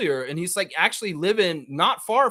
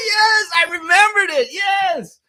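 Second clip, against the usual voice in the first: loud, high cheer with laughter; gleeful shout of triumph; triumphant laugh; 8 dB louder, slower than usual. Real, not acted. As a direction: shout it out proudly. Triumphant after remembering the song.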